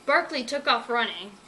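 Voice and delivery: usual speaking voice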